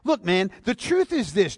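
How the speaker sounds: ordinary talk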